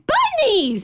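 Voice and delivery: put-on high squealed toddler cry; high excited little-kid shout. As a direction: shriek it like a thrilled toddler. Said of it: "and he's like".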